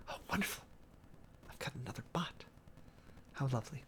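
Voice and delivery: under the breath, unimpressed by the spam; dry muttered aside. Real, not acted. Spotting a bot in chat, a dry quiet aside. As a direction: drop to a near-whisper, dry and sarcastic.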